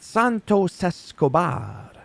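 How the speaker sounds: high, slow, drawn-out ring-announcer call of Santos Escobar. Shown as a demonstration. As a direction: stretch the name out like an announcer. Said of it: "I don't know why I do that".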